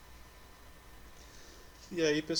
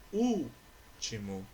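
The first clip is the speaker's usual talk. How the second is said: "Último" with a long held Ú, then the rest; stress on antepenultimate syllable; much slower than usual. Shown as a demonstration. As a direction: stretch the first syllable of "Último" very slowly. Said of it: "aqui é a sílaba tônica". (Último bem devagar, alongando o Ú inicial)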